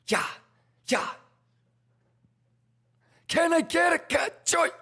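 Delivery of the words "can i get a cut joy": hype shouting. Real, not acted. Hype calls to chat.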